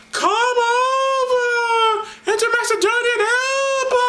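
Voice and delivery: high, slow, wailing plea; pleading Macedonian man. Acted. Man of Macedonia begs Paul for help in a vision. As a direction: beg across the water, voice high.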